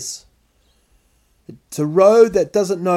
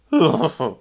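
heavy sigh. Shown as a demonstration. Comedian demonstrating his stressed sigh. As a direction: sigh it out heavily. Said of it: "I make that noise".

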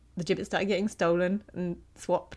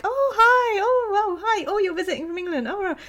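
much higher, loud, gushing greeting; gushing friends; 12 semitones higher, 8 dB louder than usual. Retold or imitated. Mimicking friends gushing over the visiting brother, much higher. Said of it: "and they're like".

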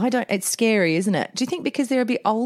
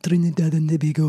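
Trinidad and Tobago lower, in a put-on accent; mock pageant accent; 5 semitones lower than usual. Co-host doubts locals say it so, mock accent. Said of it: "I bet they don't say it like that".

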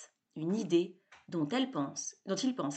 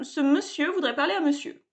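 higher, stiff butler voice; haughty butler retort; 7 semitones higher, 3 dB louder than usual. A narrator's reading. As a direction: answer back like a prim butler. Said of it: "dit le majordome".

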